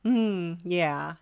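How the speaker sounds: ordinary delivery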